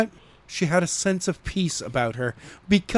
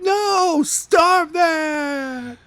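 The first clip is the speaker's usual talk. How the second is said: frustrated shout; 12 semitones higher, much slower than usual. Retold or imitated. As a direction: yell at the earbuds to stop. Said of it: "I was like".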